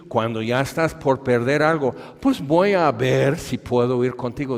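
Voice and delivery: high, loud gloating taunt; mocking gloat. Jesús burlándose de Jairo con sarcasmo fuerte. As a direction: rub it in with a cackling sneer.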